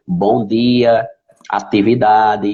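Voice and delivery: "dia" and "atividade" drawn out slowly with regional d and t; sotaque regional. Shown as a demonstration. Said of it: "As pessoas já perguntavam de onde eu era". ("dia" e "atividade" bem devagar, com sotaque regional)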